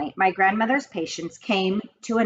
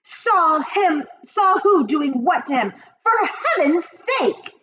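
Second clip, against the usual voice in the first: much higher, exasperated shouting; a father losing patience, voice cracking; high, cracking exasperated yell; shouted; 10 semitones higher, 4 dB louder, slower than usual. A narrator's reading. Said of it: "shouted Mister Jenkins".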